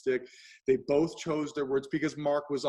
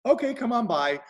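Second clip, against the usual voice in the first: cheery welcome; 9 semitones higher, 6 dB louder than usual. Retold or imitated. Agreeing to a late visit.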